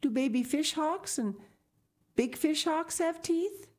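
put-on high child's voice, questioning; curious little girl. A narrator's reading. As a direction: ask eagerly like a small girl. Sal asks father.

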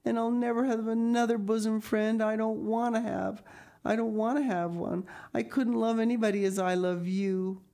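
put-on high, plaintive girl's declaration; mildly sobbing. A narrator's reading. Diana vows no other bosom friend, mildly sobbing. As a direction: protest your love with a hint of sobs. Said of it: "sobbed Diana".

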